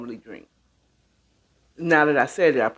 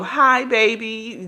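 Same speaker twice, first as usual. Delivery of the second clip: quoting a viewer; 5 semitones higher, slower than usual. Retold or imitated. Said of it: "That's what he said".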